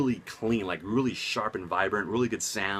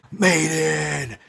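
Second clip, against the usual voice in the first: Maiden yelled loud and high; metal shout; 6 semitones higher, 8 dB louder than usual. Real, not acted.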